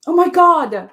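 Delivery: loud, high, drawn-out announcement; excited welcome. Real, not acted. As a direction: welcome the guest like a thrilled host. Welcoming guest onto the stream.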